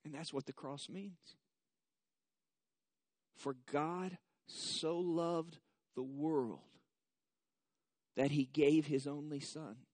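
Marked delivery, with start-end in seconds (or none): 3.3-9.8 slow, measured reading of scripture; solemn scripture reading. Acted. Pastor reading the verse slowly to the congregation. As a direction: let each word of the verse land.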